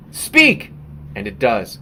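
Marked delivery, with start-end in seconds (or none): 0.1-0.7 loud, high commanding shout; demanding command. Acted. Artist ordering his unfinished work to talk: loud, high commanding shout. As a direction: bark it at the artwork like an order. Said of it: "I punch it and demand".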